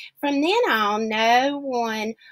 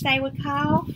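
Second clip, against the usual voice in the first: usual speaking voice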